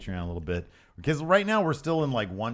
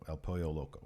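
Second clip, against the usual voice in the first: usual speaking voice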